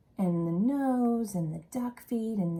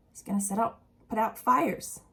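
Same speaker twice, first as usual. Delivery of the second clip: plain reading voice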